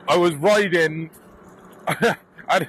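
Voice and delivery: usual speaking voice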